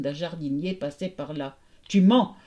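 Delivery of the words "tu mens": loud, lightly voiced accusing ghost; a touch of rattle-voiced phantom; rattling voice. A narrator's reading. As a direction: accuse sharply as a tattling ghost. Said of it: "de sa voix de crécelle".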